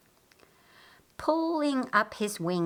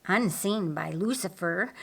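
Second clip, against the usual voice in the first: plain reading voice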